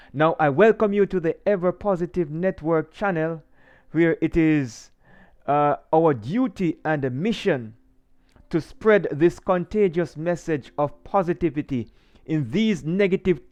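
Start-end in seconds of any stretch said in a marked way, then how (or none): none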